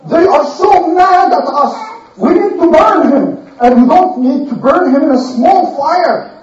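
a frantic priest whipping up the crowd; zealous priest shouting. Retold or imitated. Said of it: "the guy who talks to the boo-boo said".

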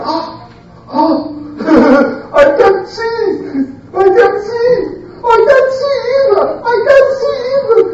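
very high, whiny mock cries; acted panicked wailing. Blinded bad guys crying out, mock high panic. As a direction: wail helplessly, hammed-up.

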